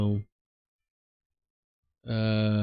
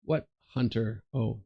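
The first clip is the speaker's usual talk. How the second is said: ordinary talk, nothing marked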